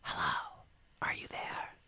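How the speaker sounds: soft unvoiced whisper. Jill finding the friends in the dark, whispering. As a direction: call out in a barely-there whisper. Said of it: "a voice whispers to them".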